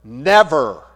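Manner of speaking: loud, falling, emphatic refusal; very firm answer. Retold or imitated. Pastor answering the nurse's depression question, very firm. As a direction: land one word firmly, no room for argument. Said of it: "give a very firm answer".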